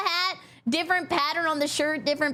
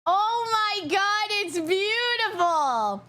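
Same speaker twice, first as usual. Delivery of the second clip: drawn-out, high gush of delight; gushing awe; 5 semitones higher, much slower than usual. Real, not acted. Streamer gushing over something beautiful, slow high gush. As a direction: stretch each word out in delighted awe.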